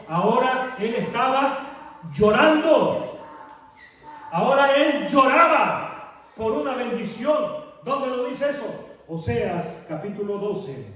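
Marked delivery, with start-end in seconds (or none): 0.1-8.7 higher and louder, fervent sermon push; preaching at full force. Acted. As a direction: raise the voice over the church. (predicando con fervor, más alto y fuerte)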